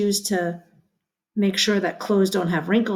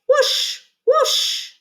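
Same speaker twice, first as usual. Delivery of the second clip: high, airy whoosh like spraying water; playful high whoosh for kids; hose whooshing. Shown as a demonstration. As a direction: swoop the voice up on each whoosh. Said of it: "Can you make the sound".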